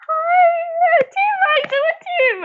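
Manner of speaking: awkward trying-to-be-cool hi. Retold or imitated. New cast member nervously greets Tim, squeaky forced-casual hello.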